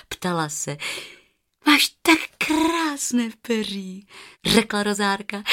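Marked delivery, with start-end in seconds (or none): 1.7-4.1 put-on higher, slow girlish voice; little girl lingering on her admiration; admiring girl. A narrator's reading. Girl admires the goose's feathers, admiring.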